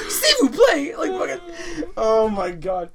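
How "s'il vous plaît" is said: high, louder hammed-up French; mock French teacher. Retelling the French teacher's reaction, in high mock French. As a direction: toss off a fake-French "please". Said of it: "she's like".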